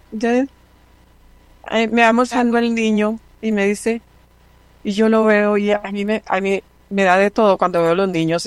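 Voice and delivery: usual speaking voice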